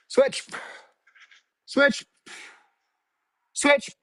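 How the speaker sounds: high, sharp coach call of switch; called-out cue. Shown as a demonstration. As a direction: bark the cue like a drill coach.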